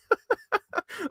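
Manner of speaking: real amused laugh; high laugh. Real, not acted. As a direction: burst of high-pitched laughter. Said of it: "I'm just laughing".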